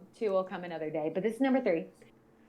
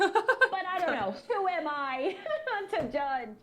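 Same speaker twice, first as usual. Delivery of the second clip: sarcastic shrug dissolving into laughter, higher; laughing; 8 semitones higher, 5 dB louder, slower than usual. Real, not acted. Mocking her felon dad, laughing it off. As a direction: laugh through the rhetorical question.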